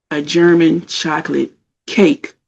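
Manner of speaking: GERMAN... CHOCOLATE... CAKE, spaced out slowly; slow scolding emphasis on each word of "German chocolate cake"; slow, pointed repeat. Retold or imitated.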